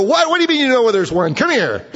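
high, loud, fast eager outburst; excited disbelief. Pretend. King Saul to his buddy about a witch, high and eager. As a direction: pounce on the news.

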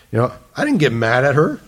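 normal talking voice

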